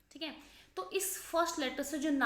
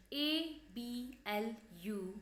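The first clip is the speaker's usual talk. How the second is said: letters spelled out one by one very slowly; slow spelling; 4 dB quieter, much slower than usual. Shown as a demonstration. Teacher spelling the word, slow and deliberate. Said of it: "एब्यूलेशन".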